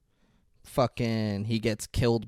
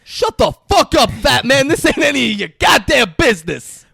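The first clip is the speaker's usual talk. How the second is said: much higher, loud, furious yell at the fat man; furious shout; 14 dB louder than usual. Retold or imitated. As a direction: bark it down at him in full rage. Jules snapping at the manager.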